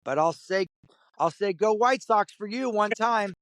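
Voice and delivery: mild, high-pitched retold yell; mock yell to someone pulling away. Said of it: "So I just yell".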